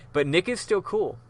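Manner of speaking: plain reading voice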